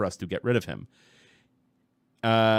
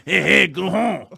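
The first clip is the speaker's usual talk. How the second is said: loud, drawn-out mock French noises; exaggerated French accent; 7 semitones higher, 9 dB louder, much slower than usual. Host shows co-host what he's doing, exaggerated French accent. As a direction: ham up a cartoon French accent, high and slow. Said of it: "I'm doing like".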